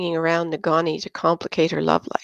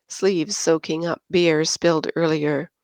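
usual speaking voice

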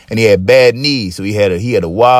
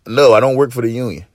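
usual speaking voice

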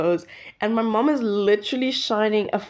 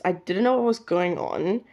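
ordinary delivery

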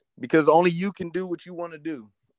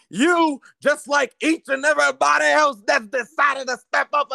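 wrestling promo; 6 semitones higher than usual. Acted. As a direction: threaten the notebook like a heel. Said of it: "could you cut us a promo".

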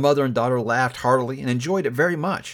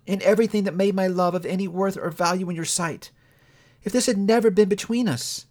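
put-on a lightly higher young woman, sad and steady; a hint of a hurt young woman letting go; Belle, lightly voiced; 7 semitones higher than usual. A narrator's reading. Young woman to her changed lover, lightly voiced and sorrowful.

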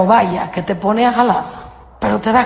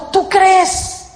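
higher, searching question; gentle question from Jesus; 6 semitones higher than usual. Acted. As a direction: ask it as if testing their faith. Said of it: "Les preguntó".

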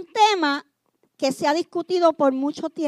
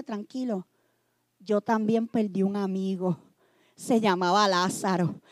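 much softer, low and tender comfort; Jesus consoling gently; 8 semitones lower, 10 dB quieter than usual. Pretend. Jesus sits beside a grieving believer, softly consoling. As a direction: soothe someone grieving beside you. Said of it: "se te sienta al lado y dice".

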